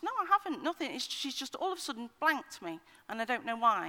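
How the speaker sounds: put-on high, upset teenage girl voice; teen daughter. Mother imitating her angry teenage daughter in the car, high and upset. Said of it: "She says".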